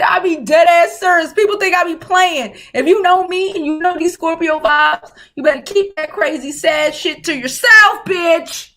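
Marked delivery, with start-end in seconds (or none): 4.0-8.7 shouted warning. Real, not acted.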